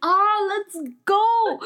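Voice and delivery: high, drawn-out excited cheer; hyped. Retold or imitated. Student retelling her reaction to the play news, hyped. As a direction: re-enact being thrilled, pitch shooting way up. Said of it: "I was so hyped".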